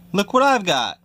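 high taunting call; high teasing call; teasing shout. Acted. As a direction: dangle it in front of the cat. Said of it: "he shouted".

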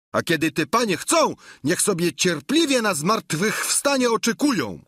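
high-pitched, agitated grumbler; outraged servant who will not be fooled; Sancho protesting loudly. A narrator's reading. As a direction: rant indignantly in a high, excitable voice. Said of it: "zawołał Sancho, nie obawiając się już przerwać milczenia".

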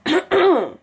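throat-clearing mock rasp; mock the rapper's raspy voice; imitating raspy rapper. Vlogger mocks the rapper's raspy voice, throat-clearing. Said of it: "If he just goes".

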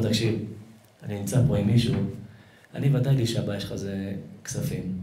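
low, softened acted voice; discreet reassurance. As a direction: offer help quietly and confidentially. Rabbi privately offers crying man help.

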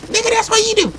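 very high, put-on retort; high-pitched voice. Player sniping back at rival, high-pitched voice. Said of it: "why the fuck you doing some high-pitched ass voice?".

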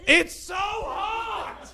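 very high squeaky shouted animal voice; hot zoo animal. Pretend. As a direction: scream it like a zoo animal melting in the heat. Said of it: "if the animals all could talk".

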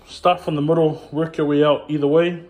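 ordinary talk

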